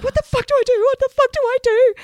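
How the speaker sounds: frantic high-pitched freak-out; panicked. Retold or imitated. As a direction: squeal it out in mock panic. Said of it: "And I was like".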